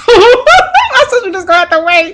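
high, loud, gleeful laughing delivery; laughing through words. Real, not acted. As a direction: laugh helplessly while you say it. Laughs about making sister wait.

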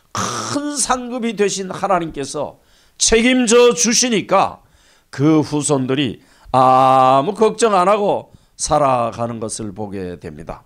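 ordinary talk

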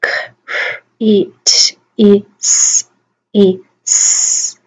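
phonemes of criticize, each isolated; "K, R, I, T, I, S, I, S" as speech sounds; sounding out. Shown as a demonstration. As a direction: separate sounds with small gaps. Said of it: "sound them out".

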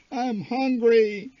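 put-on high, slow, drawn-out kid whine; droning whine. A narrator's reading. Little brother whining to his mother, droning and slow. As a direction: drag it out like a bored child. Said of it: "my brother droned".

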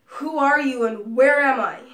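loud, high panicked demand; frightened shout. Acted. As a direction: shout it in fear. Captive demanding answers.

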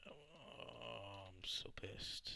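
whispered fuming; quiet real anger. Real, not acted. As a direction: seethe it under your breath. Muttering after dying.

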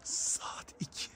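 hushed whisper. Real, not acted. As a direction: whisper so nobody wakes. Late night, site asleep.

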